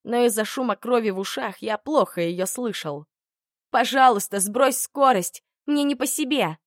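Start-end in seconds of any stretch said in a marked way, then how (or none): 3.7-6.6 high, louder frightened begging; terrified pleading hostage. A narrator's reading. Polina begs him slow down.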